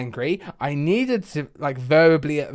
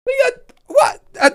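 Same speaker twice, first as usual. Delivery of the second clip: very high, squeaky, spluttered bafflement; exasperated disbelief; 3 dB louder than usual. Acted. Call handler hearing it was needed two hours ago, squeaky mock disbelief. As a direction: splutter in a squeak, words failing you. Acted disbelief at late customer demand.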